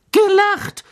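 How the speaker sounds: very high, loud punchline; spring the shock on Harry; dramatic reveal. Acted. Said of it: "fuhr Stan dramatisch fort".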